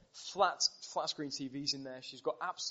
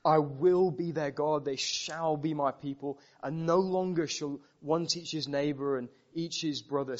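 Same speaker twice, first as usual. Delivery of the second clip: rising, louder proclamation of the verses; scripture reading; 5 dB louder than usual. Acted. Preacher reading Jeremiah to the congregation, building proclamation. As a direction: read it as God's own promise.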